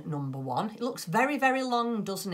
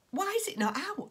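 exasperated; 14 semitones higher than usual. Retold or imitated. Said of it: "I was so upset".

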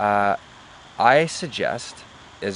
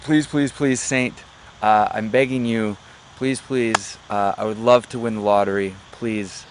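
begging, pitched up and louder on the pleases; desperate pleading; 5 dB louder than usual. Acted. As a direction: plead with a saint, acting out mild desperation. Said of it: "out of desperation to plead to the saint".

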